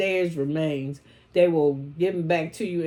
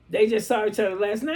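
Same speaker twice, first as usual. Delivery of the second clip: laughing; 3 semitones higher, faster than usual. Real, not acted. Laughing at the shows.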